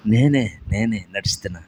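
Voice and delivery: Jesus calmly answering Peter. Acted. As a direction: answer gently from far off.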